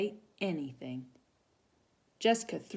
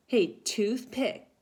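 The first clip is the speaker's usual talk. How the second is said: slow, loud mocking bully call; taunting call; 7 semitones higher, 6 dB louder, much slower than usual. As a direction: tease her across the room. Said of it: "called Brenda".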